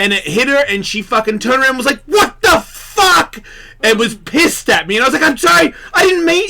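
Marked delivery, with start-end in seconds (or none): 2.0-3.4 high, loud, slow outraged cry; girlfriend's stretched-out outraged yell; angry what the fuck. Retold or imitated. Retelling his girlfriend's shocked outraged yell. Girlfriend snaps after accidental poke. 5.3-6.5 flustered apology. Retold or imitated. He apologizes to angry girlfriend.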